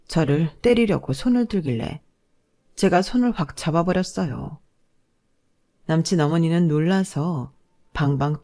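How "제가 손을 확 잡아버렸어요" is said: ordinary delivery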